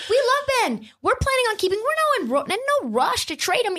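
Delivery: high, sugary mock voice; mocking Daryl Morey. Mocking team boss faking love for Ben, high mock voice. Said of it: "Daryl Morey".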